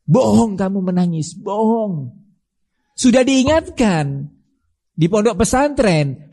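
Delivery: high-pitched scolding from the pulpit; stern rebuke. Acted. Preacher rebukes a weeping mother.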